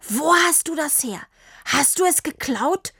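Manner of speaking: high, accusing interrogation; sharp accusation. A narrator's reading. Papa suspects Tini stole the bike, sharp accusation.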